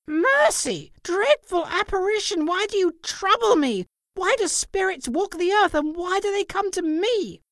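high, loud, horrified pleading; terrified cry. A narrator's reading. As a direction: cry out in terror at the ghost. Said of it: "his horror when the phantom".

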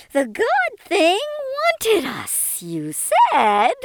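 very slow creature voice with swooping pitch; accusing old alien creature. A narrator's reading. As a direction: hurl the accusation in long, stretched alien cries. Alien female accuses Dominic.

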